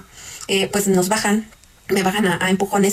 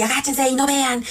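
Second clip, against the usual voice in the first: higher, drawn-out mimicked command; retold threat; 5 semitones higher, slower than usual. Robber threatening the kidnapped couple, retold threatening order. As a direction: imitate a robber ordering hostages around, voice raised in pitch. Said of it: "todo el tiempo nos están amenazando".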